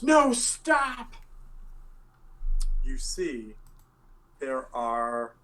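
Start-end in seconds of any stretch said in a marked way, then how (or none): 0.0-1.1 high pleading cry to stop them; desperate cry. Acted. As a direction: yell it out high in alarm. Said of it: "runs out and goes".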